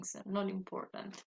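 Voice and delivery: ordinary talk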